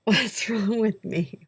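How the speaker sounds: slow, laughing self-scolding; half-laugh it out at your own folly; amused groan at yourself; laughing at herself. Real, not acted. Mock despair at skipped autograph.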